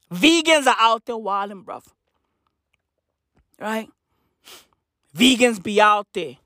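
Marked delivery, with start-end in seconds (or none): none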